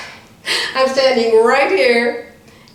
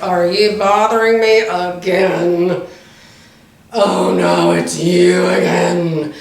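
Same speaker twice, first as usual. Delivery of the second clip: grumpy clerk; 4 semitones lower, slower than usual. Retold or imitated. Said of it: "about as friendly as a rattlesnake".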